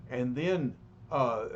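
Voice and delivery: usual speaking voice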